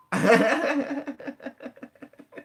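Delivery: long, high-pitched burst of laughter; hearty laugh. Real, not acted. Teacher laughing after telling student about being excommunicated, hearty laugh. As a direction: laugh it out high, letting it tail off.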